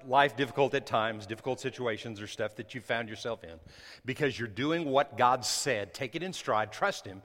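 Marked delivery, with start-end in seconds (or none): none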